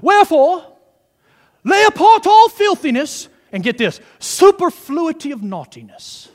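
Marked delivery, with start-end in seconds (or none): 0.0-3.3 loud, high, pompous theologian declamation; ultimate theologian. Acted. Preacher reading King James to the congregation, mock theologian voice. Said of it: "in my ultimate theologian voice". 4.2-6.3 raised, mock-grand theologian reading; ultimate theologian. Preacher showing off old King James wording, mock theologian voice. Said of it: "in my ultimate theologian voice".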